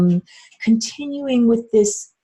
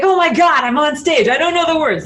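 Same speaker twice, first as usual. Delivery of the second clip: mock stage panic; 7 semitones higher, 4 dB louder than usual. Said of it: "worst thing at karaoke".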